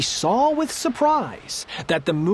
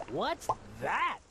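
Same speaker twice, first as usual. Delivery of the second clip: much softer alarmed question; startled; 3 semitones lower, 14 dB quieter than usual. A narrator's reading. Yertle feels his throne shake, soft startled question. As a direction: barely breathe it out, shaken.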